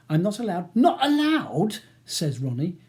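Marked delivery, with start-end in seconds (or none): 0.7-1.8 slow, loud, high incredulous echo; rising temper. A narrator's reading. Ronnie echoing Charles's refusal, temper slipping. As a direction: throw it back slowly, voice rising in anger. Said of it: "his voice rising and his temper slipping dangerously".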